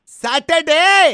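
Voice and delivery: loud, very drawn-out single word; brusque tone. Acted. Suitor proposing a day to the woman, loud and brusque. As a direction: throw the day out loudly and slowly. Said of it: "ओफो, आपकी tone".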